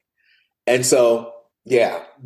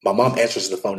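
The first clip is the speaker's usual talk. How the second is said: ordinary talk, nothing marked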